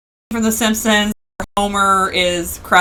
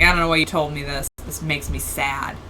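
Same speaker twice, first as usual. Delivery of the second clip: mock sad; 8 semitones lower, 7 dB quieter than usual. Wife sulking at husband's wrestling advice, mock sad mumble. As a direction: sulk it out quietly. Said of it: "I'm like".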